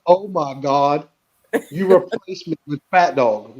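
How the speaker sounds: slow, dramatic, hurt accusation; mock wounded accusation. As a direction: play it as betrayed son, half-joking.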